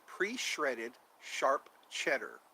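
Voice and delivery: "Pre-shredded sharp cheddar" spelled out slowly and softly; careful slow enunciation of "Pre-shredded sharp cheddar". Shown as a demonstration. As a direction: take each word carefully, slowly. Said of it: "I have to say that slowly".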